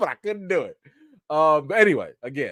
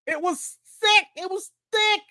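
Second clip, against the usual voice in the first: mock high voice. Repeating in put-on voice.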